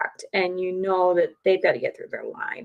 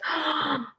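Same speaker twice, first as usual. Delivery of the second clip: sharp gasp of shock; high mock gasp of sympathy; 7 semitones higher, 4 dB quieter than usual. Stranger hears the news, sharp mock gasp.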